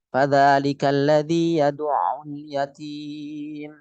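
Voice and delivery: slow, flat tajwid recitation; Quran recitation. Shown as a demonstration. Teacher demonstrating plain tajwid recitation to class, slow chant. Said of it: "Contohnya ini".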